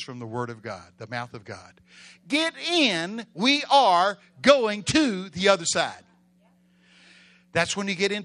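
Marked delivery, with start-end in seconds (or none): 2.3-6.0 loud, slow, raised voice; commanding declaration. Acted. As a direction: drive the order home deliberately. Re-enacts Jesus' order to disciples.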